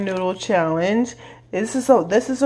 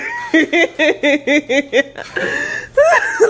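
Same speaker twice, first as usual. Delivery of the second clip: long high laugh; 6 semitones higher than usual. Real, not acted. Friend laughing at the pepper eater: long shrieking laugh.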